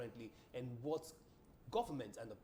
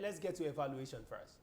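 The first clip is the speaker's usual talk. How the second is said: ordinary talk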